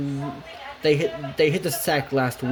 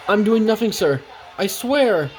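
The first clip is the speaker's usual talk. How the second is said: higher, hurried-sounding excuse; flustered protest; 7 semitones higher, 3 dB louder than usual. Acted. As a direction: plead innocence to a superior. Thomas caught out by station master.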